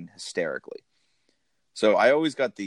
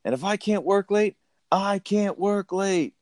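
higher, pushy boss rant, slowing at the end; mock yelling boss; 9 semitones higher than usual. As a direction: rant at the secretary like a bullying boss. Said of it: "when he's yelling at Grace".

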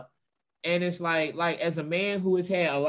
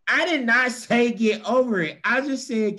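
indignant protest; 6 semitones higher, 5 dB louder than usual. Real, not acted. Defending himself to the room.

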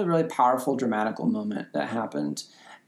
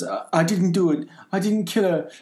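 mock bumbling plea; 5 semitones higher than usual. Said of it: "his delivery of all his lines".

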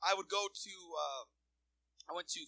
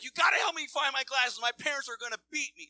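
panicked friend; 8 semitones higher, 9 dB louder than usual. Retold or imitated.

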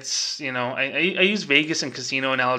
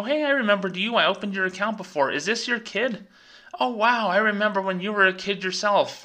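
higher-pitched, lightly acted hello to a familiar customer; friendly banker greeting; 6 semitones higher than usual. Banker greeting a long-time customer, higher-pitched friendly recognition. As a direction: play a banker delightedly recognizing someone, pitched up. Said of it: "You should go".

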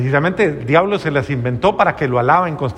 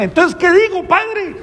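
much higher, whiny questioning voice; pleading parishioner; 14 semitones higher than usual. Retold or imitated. A parishioner fretting to the priest, in a high whiny imitation. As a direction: mimic a fretting parishioner asking what to say. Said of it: "el otro día me decía alguna persona".

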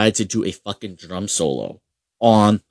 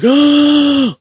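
high, loud mock "Oh!" of amazement; crowd gasp; 11 dB louder than usual. Teller imitates people wowed by a save, with a high, loud mock gasp. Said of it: "people were like".

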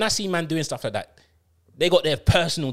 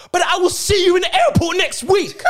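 high, loud gloating shout; shouting at the friend that the holiday is still on; mocking shout; 14 semitones higher, 5 dB louder than usual. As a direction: yell it as a cheeky taunt. Taunting friend about holiday.

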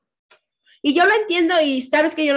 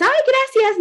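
much higher-pitched token thanks; acted polite thanks; 13 semitones higher, 3 dB louder, slower than usual. Podcaster showing how to answer a friend's message, polite thanks. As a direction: toss out a light, high thank-you.

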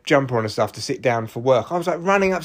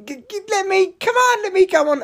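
squeaky, much higher desperate begging; mock pleading. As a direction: beg like a kid for a stage slot. Begging to get on stage.

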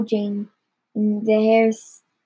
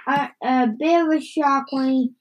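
ordinary delivery

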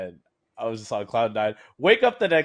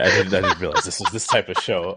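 laughing; 5 semitones lower, 7 dB louder than usual. Real, not acted. Laughing at the surprise question.

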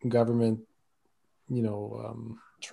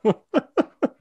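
laughing; 13 semitones higher, 3 dB louder than usual. Real, not acted. Laughing at his own accidental pun.

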